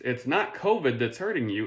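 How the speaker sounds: ordinary delivery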